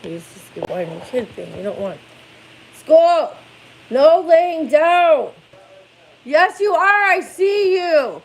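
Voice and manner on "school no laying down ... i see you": loud, high wake-up calls; calling out. Real, not acted. Mom calling her son to get up for school. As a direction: rouse him out of bed, firm.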